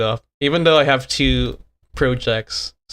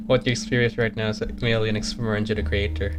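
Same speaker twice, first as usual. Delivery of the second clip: lightly voiced cryptic guide, softer; game NPC voice; 4 semitones lower, 7 dB quieter than usual. Creator character telling the player how the world works, softer. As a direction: read the cryptic lines softly and evenly.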